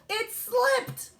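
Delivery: put-on high, loud kid voice; high-pitched little-boy excuse; David's excuse. A narrator's reading. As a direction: blurt it like a child caught out. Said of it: "he said".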